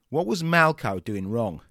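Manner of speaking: usual speaking voice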